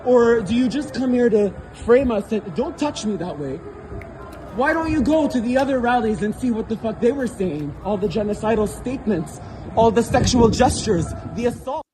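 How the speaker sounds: normal talking voice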